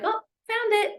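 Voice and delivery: bright high "found it" exclamation; pleased discovery. Acted. Caregiver producing the spare of a missing item, pleased discovery. Pretends to find the lost item.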